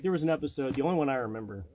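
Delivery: normal talking voice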